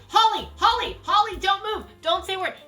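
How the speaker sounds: urgent call. Retold or imitated. Urgent warning in car.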